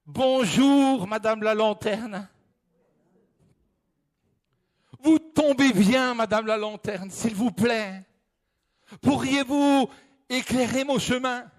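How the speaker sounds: high, eager pleading voice; pleading hiker. Acted. The lost hiker pleading with the flashlight for light. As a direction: beg politely, relieved to find help.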